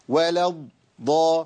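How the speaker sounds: very slow ولا الضا, the doubled ض held so it is plainly audible; over-clear Dad. Shown as a demonstration. Teacher makes the sound clearer for listeners, exaggerated held ض in الضا. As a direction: exaggerate the still ض in الضا before releasing it. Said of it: "أنا ببينه أكتر".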